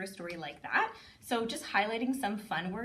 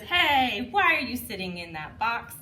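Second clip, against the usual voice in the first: playful call-out; 4 semitones higher, 8 dB louder than usual. Shown as a demonstration. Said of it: "It says".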